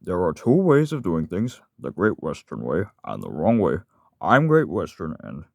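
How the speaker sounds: slow, emphatic Great Western engine; loud, swooping, self-important put-on voice; character voice. Shown as a demonstration. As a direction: proclaim it slowly as a proud engine. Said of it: "here's some other characters I like to voice".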